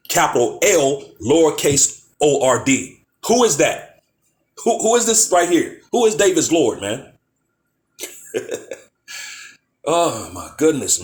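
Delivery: usual speaking voice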